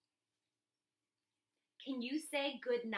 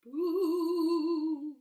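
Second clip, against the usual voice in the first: loud spooky BOO; playful monster boo; hollering werewolf; 4 semitones higher, 7 dB louder than usual. Acted.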